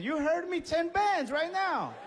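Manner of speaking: high-pitched, loud acted demand, slowing at the end; mock-outraged high, loud order; insistent demand. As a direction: insist at the top of your range, drawing out the last words.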